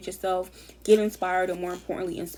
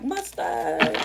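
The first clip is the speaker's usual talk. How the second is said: imitating [name]; 5 semitones higher, 4 dB louder than usual. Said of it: "He was like".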